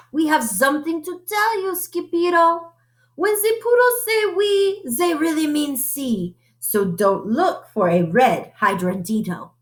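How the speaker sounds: put-on high, French-accented puppy voice; light French accent, high and playful. A narrator's reading. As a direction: tell him like poodles with French accents. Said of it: "using a French accent".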